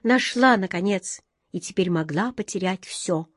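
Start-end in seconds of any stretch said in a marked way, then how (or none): none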